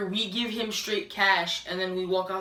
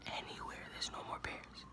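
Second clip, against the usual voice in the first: breathy whisper, much softer; 21 dB quieter than usual. Real, not acted. Echoing the sold-out news in a whisper. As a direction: whisper it like it's a tragedy. Repeats it in despair.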